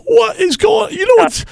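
very high, hysterical shouting; hammed-up high-pitched frenzy; mock meltdown. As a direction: cry out as if losing it. Prank: fake meltdown before reveal.

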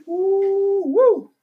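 high, hooting woo; whistle-like falsetto woo of alarm; high whoop. Acted. Comic reacts to jobless bartenders with a high mock whoop. About bartenders losing jobs.

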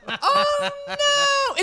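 high laughing oh no; laughing high exclamation. Real, not acted. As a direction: laugh it off, squeaking. Caught out, laughing.

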